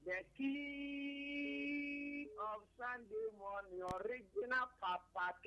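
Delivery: high, long-held hailing call; sustained high-pitched salute; drawn-out hailing. Real, not acted. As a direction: hail the host, stretching it out high. Caller hails the radio host.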